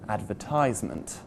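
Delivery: "advertisement" wrongly stressed on the third syllable, TISE. Shown as a demonstration. Teacher showing a weird-sounding mistake, "advertisement" stressed on syllable three. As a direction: put the weight on TISE in "advertisement". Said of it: "or syllable number three".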